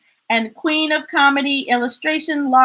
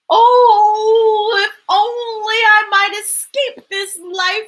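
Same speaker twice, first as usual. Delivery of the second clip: higher, dreamy sigh of a longing crab; wistful crab; 5 semitones higher, slower than usual. Acted. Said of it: "crab's wistful wish".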